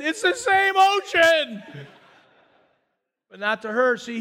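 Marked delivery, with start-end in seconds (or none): 0.0-1.9 high, slow, laughing dad; hammed-up dismissive dad; mock dad voice. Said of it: "her dad said".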